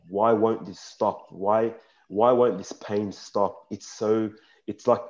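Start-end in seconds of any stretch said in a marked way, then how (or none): 0.1-3.7 slow plea. Retold or imitated. Slow pleading inner question.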